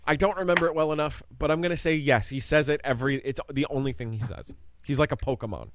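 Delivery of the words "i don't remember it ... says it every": usual speaking voice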